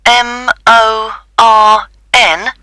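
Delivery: slow, letter by letter spelling. Real, not acted. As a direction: spell each letter slowly. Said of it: "Was it Morn?".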